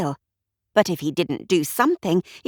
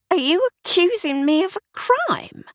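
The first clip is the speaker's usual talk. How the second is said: scornful sneer, much higher; 11 semitones higher than usual. A narrator's reading. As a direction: sneer the question with contempt. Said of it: "Willoughby sneered".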